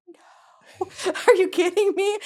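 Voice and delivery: whispered no, then high loud disbelief; retold panicked refusal. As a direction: refuse in a hushed gasp, then shriek. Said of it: "And I'm like".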